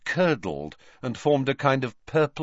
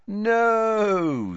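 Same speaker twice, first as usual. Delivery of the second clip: confident; 12 semitones higher, 3 dB louder than usual. Acted. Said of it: "said Harry confidently".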